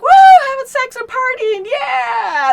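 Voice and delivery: high, whooping party cheer; wild party whoop. Retold or imitated. Mocking the party scenes with a whoop. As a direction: whoop it up like a partier. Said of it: "it was more like".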